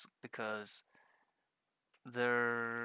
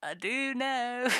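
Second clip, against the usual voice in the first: cheeky comeback; 11 semitones higher, 9 dB louder, slower than usual. Pretend. Mock reply in imagined chat.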